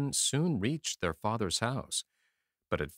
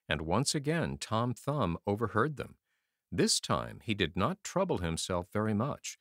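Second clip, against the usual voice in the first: ordinary delivery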